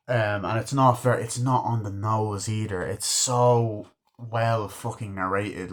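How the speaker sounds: usual speaking voice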